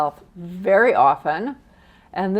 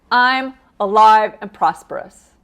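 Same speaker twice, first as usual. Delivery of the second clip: loud, emphatic, enthusiastic affirmation; with gusto; 10 dB louder than usual. Shown as a demonstration. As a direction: belt out the mantra with conviction. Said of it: "Say it with some enthusiasm".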